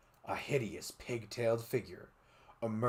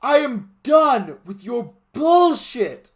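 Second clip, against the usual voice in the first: yelled; 14 semitones higher, 15 dB louder, slower than usual. Acted. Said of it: "he yelled".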